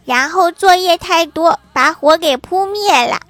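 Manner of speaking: put-on high, loud, slow kid's protest; mock a cross little kid, stretching the words; angry child. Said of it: "郭晓霞也生气了".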